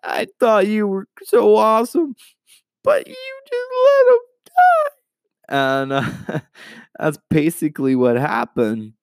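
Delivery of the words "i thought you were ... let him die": high, whining mockery of a grieving sister; squeaky, rising complaint that climbs even higher at the end; mock whiny Martha. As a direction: pitch it way up and complain like a hurt friend. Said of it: "It's like".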